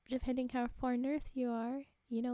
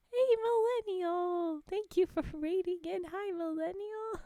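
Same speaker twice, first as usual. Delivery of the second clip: excited greeting; 6 semitones higher, 5 dB louder than usual. Real, not acted. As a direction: call out a happy welcome.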